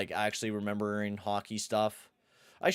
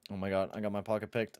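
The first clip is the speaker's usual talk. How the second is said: usual speaking voice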